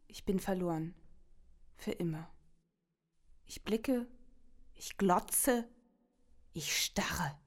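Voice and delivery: very soft, emptied-out resignation; hushed despair. Acted. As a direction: sink into a near-whisper, lost. Despair after the outburst, lost. (leise, verloren, fast flüsternd)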